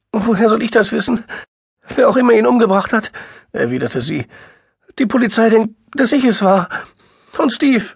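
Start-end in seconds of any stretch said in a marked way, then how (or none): 0.1-3.1 high, loud, fast and frantic; frantic sister near tears; distraught woman. A narrator's reading. 5.0-7.8 high, loud, fast and frantic; frantic sister near tears; distraught woman. A narrator's reading.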